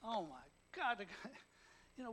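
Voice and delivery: ordinary talk, nothing marked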